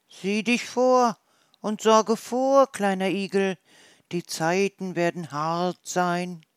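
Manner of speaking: elders cautioning the little one; warning old hedgehogs. Acted.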